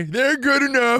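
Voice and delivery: high announcer read of "good enough"; intro voice imitated. Quoting the show intro in a high announcer voice. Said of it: "show intro say".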